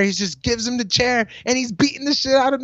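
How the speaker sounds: normal talking voice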